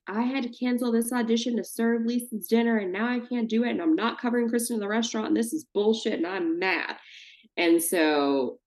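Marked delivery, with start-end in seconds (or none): 0.1-7.0 higher, lightly voiced angry rant; a touch of Scheana's outrage at Peter; retelling Scheana's angry outburst in the alley; mock angry Scheana. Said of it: "she gets so angry because she's like".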